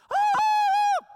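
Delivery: piercing falsetto shriek at full volume; wife's terrified scream at a bat; high-pitched scream. Retold or imitated. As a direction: shriek as high as you can go. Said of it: "That's as high-pitched a scream as I can muster".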